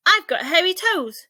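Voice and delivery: loud, high cheerful shout. A narrator's reading. Ike shouting to the giraffe from a branch. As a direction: belt it out like a proud little monkey. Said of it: "shouted Ike".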